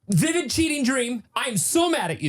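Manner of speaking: teasing mock-angry reading; mock outraged text. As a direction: read her text in a hammed-up angry voice. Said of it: "your first text to me".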